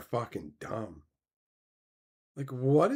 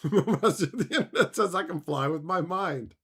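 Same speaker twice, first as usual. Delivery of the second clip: laughing mockery; 4 semitones higher than usual. Mocking while laughing.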